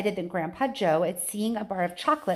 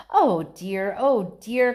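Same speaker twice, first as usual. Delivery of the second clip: louder fretting oh dear; worried cry; 6 dB louder, much slower than usual. A narrator's reading. Mister Wonka frets over the boy, worried. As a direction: fret out loud. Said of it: "cried Mister Wonka".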